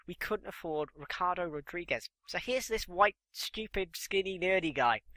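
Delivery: normal talking voice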